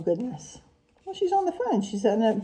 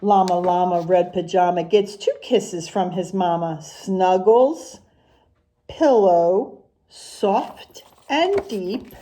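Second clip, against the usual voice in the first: sing-song rhyming picture-book read, unhurried; reading to children; slower than usual. Acted. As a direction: read the rhymes to the class with a lilt. Teacher reads picture book aloud.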